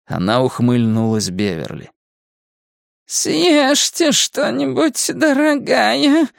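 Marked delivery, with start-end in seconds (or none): 3.1-6.3 very high, slow, creaking witch voice; creaky crone. A narrator's reading. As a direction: creak it out like a crypt door on hinges. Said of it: "Голос поднялся на полоктавы, но в нём прибавилось скрипучести". (скрипучая старуха, очень высоко и медленно)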